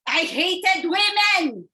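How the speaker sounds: slow, loud, high shout of hatred; bitter shouted confession; bitter outburst. Acted. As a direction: spit out the hate, drawn out. Month-character's bitter confession.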